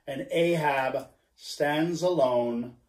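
plain reading voice

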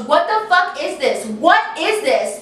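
retold angry confrontation, slowing on the repeated question; going at him. Friend confronts boyfriend over the texts, acted outrage. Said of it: "she starts going at him".

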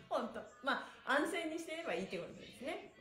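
usual speaking voice

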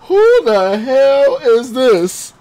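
high, loud, slow and giddy; laughing hype at a donor's name; hyped laughing greeting. Real, not acted. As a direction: stretch it out, cracking up.

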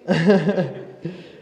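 a burst of loud laughter. Real, not acted. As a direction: laugh out at how odd it was. Laughs at his own story.

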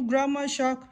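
ordinary delivery, nothing marked

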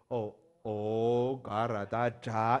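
slow, careful spelling; refined reading. Shown as a demonstration. Teacher showing the literati way, slow. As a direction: spell it the scholarly way, slowly. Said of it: "文人的念法的话是什么".

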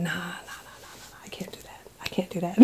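breathy, hushed whisper, nearly silent; whispered refusal. Retold or imitated. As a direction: whisper it like you're afraid to be overheard. Panicked inner refusal, hiding in store.

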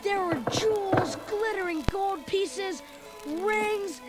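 usual speaking voice

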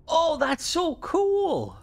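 a real thrill at the talking ship; delighted. Real, not acted. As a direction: gush over the talking ship. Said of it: "delighted at the mod working".

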